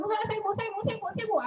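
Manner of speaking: eager students calling out. Retold or imitated. Teacher mimics students clamoring to answer.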